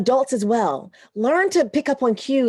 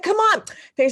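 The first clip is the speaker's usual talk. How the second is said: higher-pitched coaxing of a sister; mock pleading, pitched up; coaxing plea; 10 semitones higher than usual. Retelling argument with her sister.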